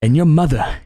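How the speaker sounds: low, firm dad voice; stern dad. Retold or imitated. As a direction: lay down the law as the dad. Dad laying down rules.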